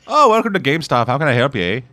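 a hint of a Minnesota clerk; Fargo accent. Teasing the clerk as if from Fargo, light Minnesota accent. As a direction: lightly put on a Fargo lilt. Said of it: "sounded like he was from Fargo".

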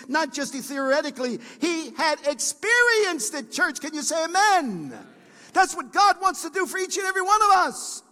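high, loud, rising sermon cry; preaching at full force. Acted. Pastor lifting the congregation, preaching at full force. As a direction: preach it out to the church.

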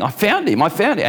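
high-pitched, hammed-up protest; frantic insistence. Reporter back in London telling of his find, hammed-up frantic insistence. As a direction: climb into a squeaky, exasperated cry. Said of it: "he's saying".

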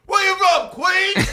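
high, loud shouted question; high shouted impression. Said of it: "just go".

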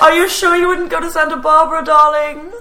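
put-on playful old Hollywood voice; transatlantic accent.

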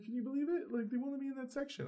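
high, put-on teen voice; plaintive imitation. Retelling excluded teen's complaint.

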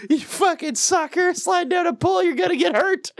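put-on high, taunting kid voice; mocking taunt. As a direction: jeer at the kids who slide down. Taunting kids on the slide.

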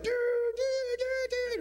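rapid very high dudes, panicked; crashing dudes. Acted. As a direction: yelp dude dude dude as they crash. Crashing on surfboards.

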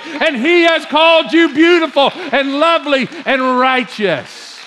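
impassioned, driving sermon voice; fired-up preaching. Real, not acted. Preacher tired of people doubting their worth, fired-up and emphatic. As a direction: press each phrase home with fervor.

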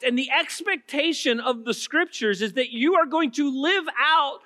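fervent revival preaching, voice ringing; full-force preaching. Acted. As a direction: preach it out to the whole room.

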